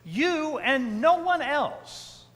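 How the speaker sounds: "You and no one else" slow and high, stress on "no"; emphatic you. Shown as a demonstration. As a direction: drag out "You and no one else", leaning hard on "no". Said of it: "it's in the emphatic".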